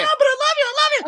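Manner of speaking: very high, giddy mock Anna voice; Anna quote. Brother mocks Anna gushing over her fiancé, in a very high giddy voice. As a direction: squeal it like a lovestruck girl.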